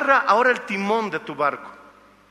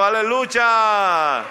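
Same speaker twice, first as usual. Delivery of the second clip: "Aleluya" stretched very slow and louder; "Aleluya" sung out slowly, a bit louder; drawn-out hallelujah; 3 semitones higher, 5 dB louder, much slower than usual. Real, not acted. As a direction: hold "Aleluya" long in praise. Drawn-out praise.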